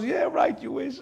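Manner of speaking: laughing refusal. Acted. Butcher refusing the widow, laughing. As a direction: laugh her request away.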